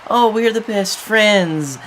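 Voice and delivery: sneering imitation of the rival team; mocking rivals. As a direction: mimic them like smug phonies. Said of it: "they promote".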